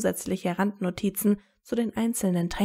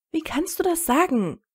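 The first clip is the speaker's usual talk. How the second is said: Hermine faucht höher und scharf; empört zurückschnappen; wütend geschnappt; 8 semitones higher than usual. A narrator's reading. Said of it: "schnappte Hermine wütend". (Hermine fährt Harry wütend an)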